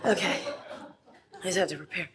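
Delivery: shaky nerves before her turn; stage fright. Acted. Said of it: "I'm nervous".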